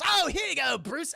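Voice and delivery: high, taunting villain; Joker. Retold or imitated. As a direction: toss it off with showy glee. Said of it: "Joker's like".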